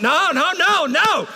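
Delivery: loud, high, laughing no no; laughing protest. Real, not acted. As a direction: call out the mistake, laughing. Crowd claps wrong, he calls out.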